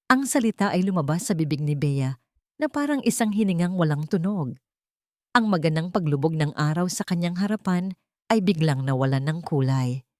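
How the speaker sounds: usual speaking voice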